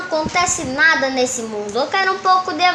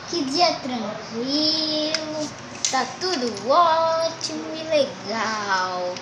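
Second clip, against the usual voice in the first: slow and calm; 4 dB quieter, much slower than usual. Pretend. Playing calm before the action.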